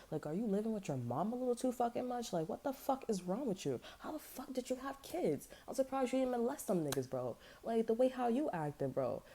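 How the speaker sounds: high-pitched, exasperated rant; retold scolding, high and appalled; incredulous telling-off. Acted telling-off of ex's mother.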